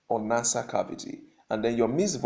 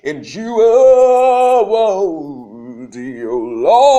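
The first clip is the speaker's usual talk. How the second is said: creaky fry then soaring high singing; vocal fry; 16 dB louder, much slower than usual. Shown as a demonstration. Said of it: "Vocal fry".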